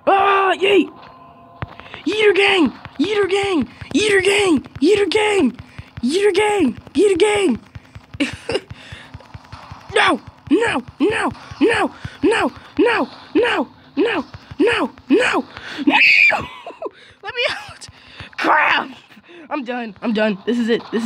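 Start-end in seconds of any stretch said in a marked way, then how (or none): 0.1-7.6 high loud chanting yells; hype shout. Real, not acted. 9.9-18.9 high loud repeated no; panicked yelling. Real, not acted.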